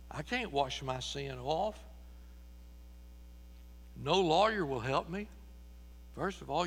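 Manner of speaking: ordinary talk, nothing marked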